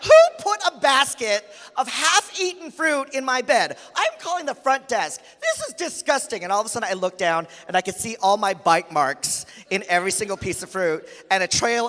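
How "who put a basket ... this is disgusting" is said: high, indignant rant; hammed-up appalled hotel guest; mock outrage. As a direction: sputter in scandalized disbelief. Outraged guest complains about fruit.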